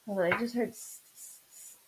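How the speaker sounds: stuck on the s, repeating it; catching on the first sound, unable to get past it; real stutter. Real, not acted. Medium stumbling on a name she's hearing, stuttering. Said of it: "I'm starting to stutter".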